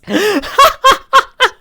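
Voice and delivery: loud high-pitched cackle; high laugh. Real, not acted. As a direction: burst out laughing. Laughs at age joke.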